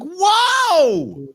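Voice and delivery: loud, very high cry of shock; exaggerated outburst of disbelief; shocked viewer. Retelling a viewer's reaction to a vile twist, shocked.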